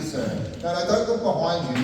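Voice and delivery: ordinary delivery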